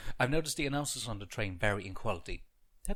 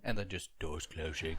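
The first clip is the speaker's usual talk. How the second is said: passionless; 5 semitones lower, slower than usual. Retold or imitated. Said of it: "lost their passion".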